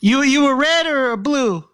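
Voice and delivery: put-on high kid asking a gang question. Kid quizzing his mom about gangs, high-pitched.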